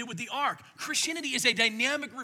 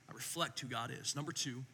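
normal talking voice

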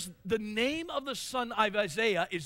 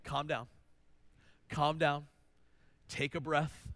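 put-on lower, gentle calm down to a frantic kid; soothing parent; 5 semitones lower than usual. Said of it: "you're like".

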